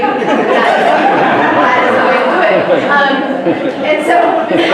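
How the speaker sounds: real laughter through loud words; words riding on a big laugh. Real, not acted. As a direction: say it loudly while cracking up. Laughing at the retold commercial.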